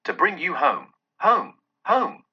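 put-on high, eager child voice; little girl. A narrator's reading. Child bringing her brother home, high and eager. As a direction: pitch leaping up on the repeats. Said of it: "said the child".

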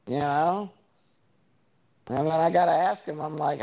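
ordinary talk, nothing marked

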